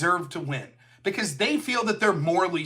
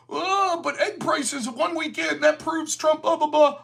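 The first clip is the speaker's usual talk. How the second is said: higher, sneering parody; mocking whine; 7 semitones higher than usual. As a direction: mimic them waving it away with blah blah. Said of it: "they are out there saying".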